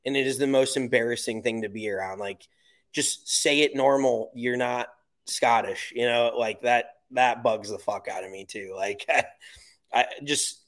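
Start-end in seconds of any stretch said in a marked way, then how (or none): none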